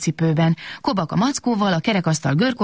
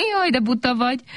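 higher, amused teasing voice; laughing; 8 semitones higher than usual. Acted. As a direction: laugh at the silly sunflower. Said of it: "kacagott Kobak". (kacagva, magasabb hangon)

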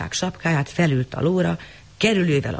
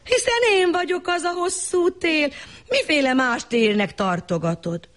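higher, teasing beggar voice; a sly beggar claiming to be winter; sly tall beggar, higher pitched; beggar's voice; 12 semitones higher than usual. Acted. Beggar speaks as long winter.

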